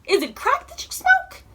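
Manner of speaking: a high squeaky jab at the thief; incredulous squeal. Real, not acted. Asking the thief if they smoke crack, incredulous high squeal.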